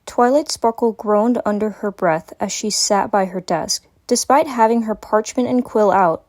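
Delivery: ordinary delivery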